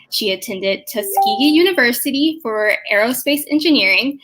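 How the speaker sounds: ordinary talk, nothing marked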